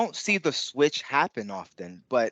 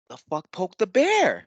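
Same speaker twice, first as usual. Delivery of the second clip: startled aside; 5 semitones higher than usual. Retold or imitated. Training partner sees his friend's angry eyes, startled. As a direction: blurt it out, caught off guard.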